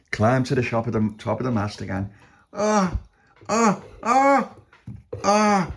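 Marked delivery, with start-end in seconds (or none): 2.5-5.7 short high "oh" cries; acted effort cries. A narrator's reading. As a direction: cry out with each climbing step. Climbing the mast.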